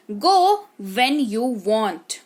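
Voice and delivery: very slow, careful teaching read; slow model sentence. Shown as a demonstration. Teacher modelling sentence slowly for the class. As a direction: say Go when you want word by word. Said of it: "teacher models English sentence".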